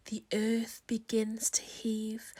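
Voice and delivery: ordinary delivery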